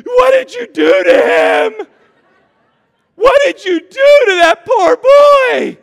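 hammed-up high, loud shriek; outraged Mama Bear. Husband mimicking wife defending her boy, outraged mock yell. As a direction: yell it slowly like an accusing mother.

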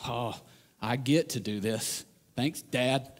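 low, soft, slow dry sarcasm; deadpan mock gratitude to Dad; sarcastic. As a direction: say it with a sarcastic smirk. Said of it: "with this sarcastic smile on his face going".

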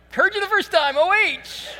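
high-pitched, puzzled comeback; exasperated echo. Retold or imitated. Clueless fan replies to the stranger, high exasperated echo. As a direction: answer the chant high, as if it's obvious. New fan answers the repeated chant.

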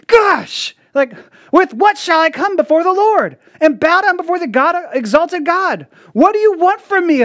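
high, whiny exasperated complaint; exasperated people. Acted. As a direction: sigh it out like a fed-up crowd. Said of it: "a more of exasperated response".

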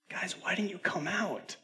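high, hushed questioning voice; pleading father. Retold or imitated. Father asks his found children.

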